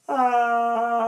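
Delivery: loud high open ah; demo vocal sound. Shown as a demonstration. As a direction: belt out an ah sound. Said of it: "you'll produce a sound".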